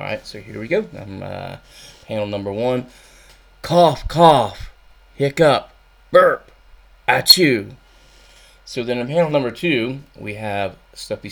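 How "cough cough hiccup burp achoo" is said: loud hammed-up cough, hiccup, burp and sneeze; comic cough and burp noises. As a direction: act out the sick noises big and loud. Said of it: "my reenactment".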